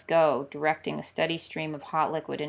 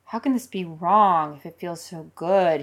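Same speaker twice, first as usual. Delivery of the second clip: wondering aloud; 5 dB louder than usual. Acted. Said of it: "he wonders".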